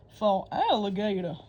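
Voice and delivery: a hint of alligator. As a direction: say it as the beast. Alligator speaks.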